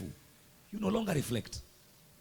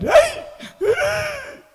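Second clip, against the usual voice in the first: high, laughing joyful cry; mock overjoyed cry; 11 dB louder than usual. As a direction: squeal with delight at the gift. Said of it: "receives a gift and says".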